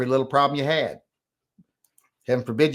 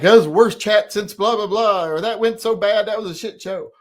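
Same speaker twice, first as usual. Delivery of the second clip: high, loud mock griping; exaggerated complaining voice, mocking them; mimicked complaint; 9 semitones higher, 6 dB louder than usual. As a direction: imitate critics griping, pitched way up. Mimicking critics of the chat.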